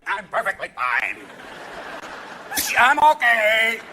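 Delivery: slow, strained drawn-out insistence; stretched-out mock reassurance; Cheney. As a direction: drag the words out, swelling loud at the end. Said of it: "He was like".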